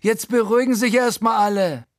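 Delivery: viel höher und laut herausgeplärrt; plärren. Acted. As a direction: schrill gebrüllt, um alle zu übertönen. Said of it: "Frankie plärrt". (Frankie plärrt die aufgeregte Gruppe viel höher und laut an)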